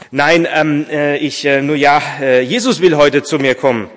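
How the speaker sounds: flustered, halting confession; hesitant admission. A narrator's reading. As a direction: hem and haw, then come out with it. Embarrassed answer to the visitor.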